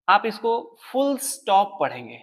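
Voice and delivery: फुल स्टॉप slowly, s moved onto फुल; careful pronunciation. Shown as a demonstration. Teacher modelling फुल स्टॉप for students. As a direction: spell out फुल स्टॉप the right way. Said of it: "पढ़ने के लिए मैं तरीका बता रहा हूं".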